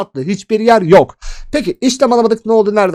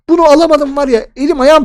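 frustrated outburst; 7 semitones higher, 4 dB louder than usual. Real, not acted. Frustrated over missed trade.

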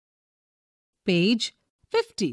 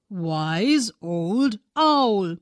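ordinary delivery, nothing marked

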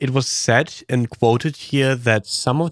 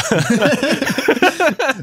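real bright laugh, pitched up high; 3 dB louder than usual. Real, not acted. Amused listener laughing at the cringe remark. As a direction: burst out laughing at the joke.

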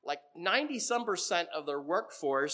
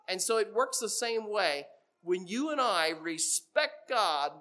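normal talking voice

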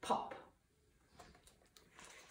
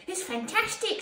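high-pitched, excited exclamation; delighted praise; 11 semitones higher, 4 dB louder than usual. A narrator's reading. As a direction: gush with admiration, pitched way up. Al admires the sculpture.

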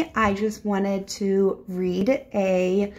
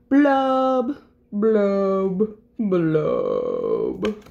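glum fish blubbing; much slower than usual. Acted. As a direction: draw out each blub, sinking down. Gloomy fish's drawn-out blubs.

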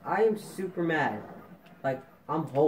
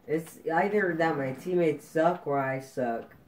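usual speaking voice

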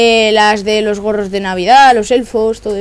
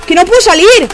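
loud, higher, fed-up cry; frustrated shout; 6 semitones higher, 6 dB louder than usual. Real, not acted. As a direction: shout that you're stuck. Stuck in the game.

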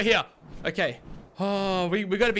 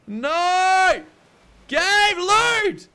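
higher, louder plea; yelling at the game; 7 semitones higher, 6 dB louder, much slower than usual. Real, not acted. Player begging a frozen game to load, loud.

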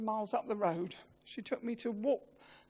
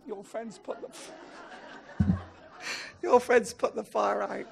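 laughing through it; slower than usual. Real, not acted. Firemen's line, laughing.

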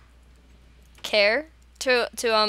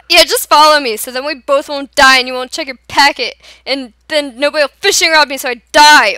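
shouting; 4 semitones higher, 11 dB louder than usual. Real, not acted. Raging at teammate in game.

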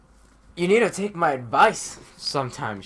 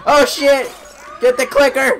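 panicked shout; 12 semitones higher, 10 dB louder than usual. Real, not acted. Panic in game fight.

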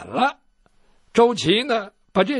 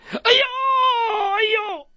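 pained howl. Acted. Doctor yelling as his ear is cut, high pained howl.

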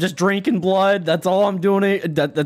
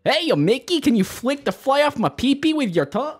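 high dopey cartoon dog; Goofy voice; 9 semitones higher than usual. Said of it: "pretty good Goofy".

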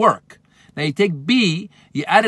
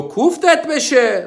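scolding; 9 semitones higher, 3 dB louder, much slower than usual. Pretend. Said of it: "You go".

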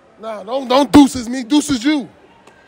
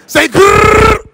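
high, loud rapper growl grrr; demo growl; 11 semitones higher, 11 dB louder than usual. Shown as a demonstration. Host demoing a rapper ad-lib, loud growl. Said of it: "Yes, you can".

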